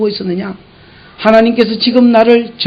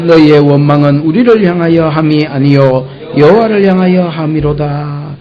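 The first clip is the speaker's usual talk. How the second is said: scripture reading; 4 semitones lower than usual. Acted. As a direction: read Moses' words out with solemn weight.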